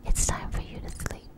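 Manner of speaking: ordinary delivery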